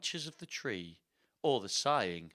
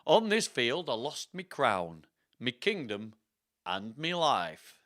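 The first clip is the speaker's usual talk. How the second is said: slow, theatrical put-on accent; Richard the third. Richard's spirit telling how he lost his crown, slow accented storytelling. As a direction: narrate as the dead king, deliberately and dramatically. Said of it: "that's gonna be his voice".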